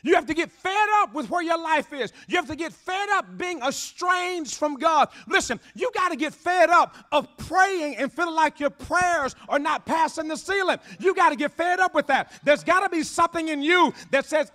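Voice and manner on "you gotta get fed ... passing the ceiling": normal talking voice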